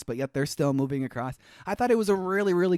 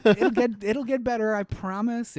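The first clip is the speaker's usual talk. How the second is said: put-on a botched Irish accent that lands Russian, pitched higher; Russian-sounding accent; 6 semitones higher than usual. Voicing John's promise in a slipping, Russian-tinged accent. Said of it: "It's just Russian sounding".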